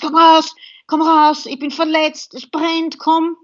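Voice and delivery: high, urgent calling out for help; retold yelling. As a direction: yell at the pilot to get out. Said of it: "zu schreien begonnen".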